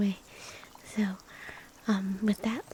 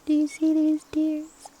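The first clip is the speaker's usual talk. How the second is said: higher, louder mock-tearful plea; hammed-up tearful cry, higher and louder; louder mock tears; 6 semitones higher, 6 dB louder than usual. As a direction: play up the fake tears, pushing it out. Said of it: "You're gonna make me cry".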